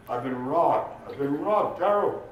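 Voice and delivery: high, upset wail to the police; distressed complaint. Acted. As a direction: report it like a disaster. Drunk reports a robbery in alarm.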